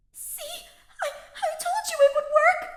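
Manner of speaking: stammering triumphant squeal; nervous excited snip. Acted. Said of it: "Bernadetta's voice snipped".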